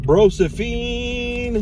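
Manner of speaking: drawn-out Brosephine. Pretend. Riffing on Broseph nickname.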